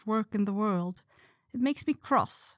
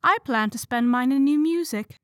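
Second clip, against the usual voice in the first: little sigh; 6 semitones higher, 6 dB louder than usual. A narrator's reading. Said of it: "said Beth with a little sigh".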